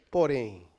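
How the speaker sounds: porém dropped low and set apart after a pause; dramatic low porém. Shown as a demonstration. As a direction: drop porém low and let it hang. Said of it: "eu tô enfatizando isso aqui". ("porém" grave e isolado)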